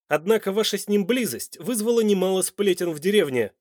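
loud, high accusation; angry. A narrator's reading. Man confronts a woman with village gossip, angrily. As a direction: accuse her heatedly. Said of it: "в изумрудных глазах вспыхнул гнев".

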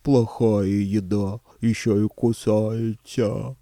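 slow dim-witted monster voice; friendly monster voice. Acted. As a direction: slowly complain like a giant. Monster voice continues.

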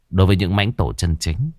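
plain reading voice

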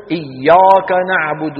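"إياك" mit dunklem, schwerem A, falsch; wrong heavy alif. Shown as a demonstration. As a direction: das A in "إياك" hohl und gedehnt, als Fehlerbeispiel. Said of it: "لا نقول".

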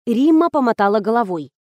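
ordinary delivery

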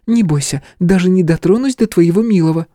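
put-on tipsy young woman. Tipsy Stella teases.

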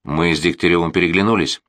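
plain reading voice

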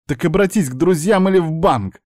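high-pitched, indignant retort; exasperated anger. A narrator's reading. Niece answers plea for money, exasperated. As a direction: push his plea away sharply.

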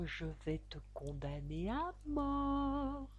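soft, very slow storybook verse; poem recitation. A narrator's reading. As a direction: recite slowly and quietly, stretching the line endings. Recites the tail-shaped poem. (récitation lente et feutrée d'un poème)

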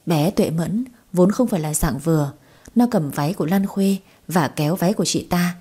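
ordinary delivery, nothing marked

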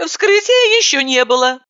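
put-on high, matter-of-fact young woman; knowing heroine voice. A narrator's reading. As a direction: state it like you know best. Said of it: "с сознанием дела ответила я".

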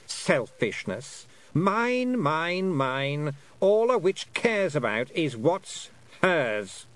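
high, slow, drawn-out queenly mockery; mock her greed as a queen, pitched high; scornful Queen. A narrator's reading. Queen accusing the witch of selfishness, high scornful voice. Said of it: "said the voice of the Queen".

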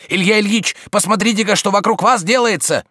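high, loud alarm; frightened. A narrator's reading. Servant urging master to look around, frightened. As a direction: cry out as if disaster struck. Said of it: "вдруг испуганным голосом заговорил он".

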